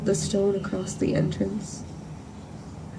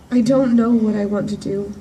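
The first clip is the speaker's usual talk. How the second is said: ordinary talk, nothing marked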